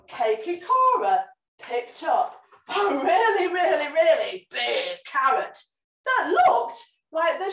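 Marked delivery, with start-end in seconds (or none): none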